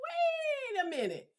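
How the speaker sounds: very high, soft disbelief; high-pitched pleading protest, easing down gradually; incredulous reaction. Retold or imitated. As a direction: object in a squeaky, aghast tone. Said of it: "I was like".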